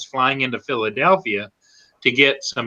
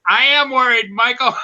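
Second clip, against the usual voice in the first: higher-pitched, insistent mock fretting; mock worry; 9 semitones higher than usual. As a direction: protest with playful exasperation, pitch raised. Acts out his worried reply.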